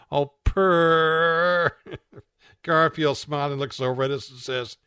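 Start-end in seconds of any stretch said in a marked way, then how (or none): 0.1-1.8 cat purr. Acted. Cat purring contentedly.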